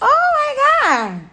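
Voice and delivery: high, drawn-out laughing exclamation. Real, not acted. Vlogger laughing at her own joke, high and drawn out. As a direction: laugh it off in disbelief.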